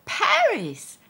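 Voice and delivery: high, loud delighted gasp of a question; excited host surprise; surprised. Acted. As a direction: leap up in pitch at the news. Host excited on radio show.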